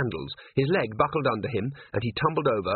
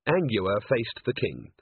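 plain reading voice

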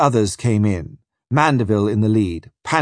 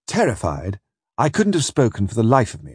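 ordinary delivery, nothing marked

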